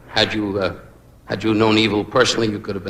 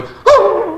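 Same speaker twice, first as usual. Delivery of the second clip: a hooting cry, much higher and loud; mock desert cry; 6 dB louder than usual. Comic retells the broad in the desert, high hooting cry. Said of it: "lay in the damn desert going".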